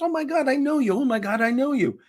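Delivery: very high-pitched gushing fan imitation; thrilled recognition. Board member meets the comedian, thrilled imitation. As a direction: squeal it high, mimicking a starstruck fan.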